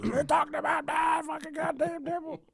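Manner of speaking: high-pitched outraged mom; angry mom voice. Retold or imitated. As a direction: squeal it like a scandalized mother. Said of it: "My mom's gonna call me".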